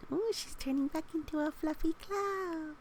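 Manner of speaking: high, bright-eyed ooh of delight; delighted wonder. Real, not acted. Reader to children, marvelling at the cloud.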